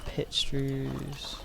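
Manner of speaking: ordinary talk, nothing marked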